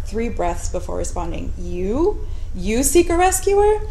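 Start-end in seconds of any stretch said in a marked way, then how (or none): 1.6-3.8 high, incredulous surprise; can't believe her ears; disbelieving. A narrator's reading. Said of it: "Scarcely believing what was said".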